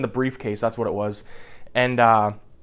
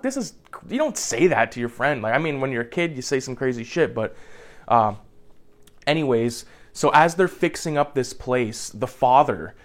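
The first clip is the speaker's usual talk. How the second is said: ordinary delivery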